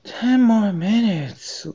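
high, slow, soft sleepy grumble; grumbling hare. A narrator's reading. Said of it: "grumbled the hare".

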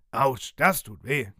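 slow, gruff pirate grumble; pirate growl. Acted. Pirate captain pinches himself, growls slowly. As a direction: growl it like a sore old pirate. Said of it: "knurrt er".

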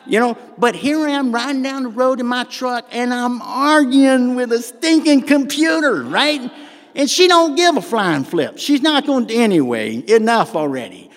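high, loud comic exasperation; exasperated rant. Retold or imitated. Preacher retells arguing with his truck's computer, loud exasperated rant. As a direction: work yourself up into a mock-indignant rant.

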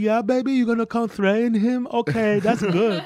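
high mocking girlfriend voice; girlfriend impression. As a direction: pitch way up as the girlfriend. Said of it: "the impression that I do of you".

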